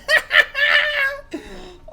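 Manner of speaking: very high loud laughing shriek; real high laughing fit. Real, not acted. Laughing at scene.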